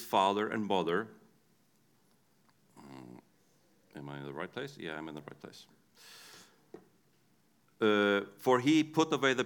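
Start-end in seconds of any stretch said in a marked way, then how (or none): none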